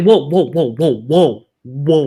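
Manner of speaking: loud, worked-up mock protest; imitating emotional outburst. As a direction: play him losing his cool, hammed-up. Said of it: "He gets, like, so emotional".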